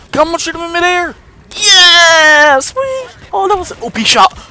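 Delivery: very high, loud excited cheer; excited yelling. Real, not acted. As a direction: cheer the big hit. Victory excitement.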